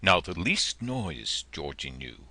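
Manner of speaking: usual speaking voice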